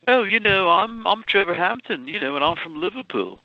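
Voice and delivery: lightly imitated Liverpool accent; put-on Liverpool accent. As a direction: sell yourself as a Liverpool lad. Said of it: "I used to speak that way".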